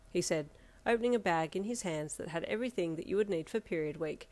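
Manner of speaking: plain reading voice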